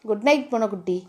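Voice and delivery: low, soft endearment; tender goodnight. A narrator's reading. Man hugs woman close at bedtime, tender.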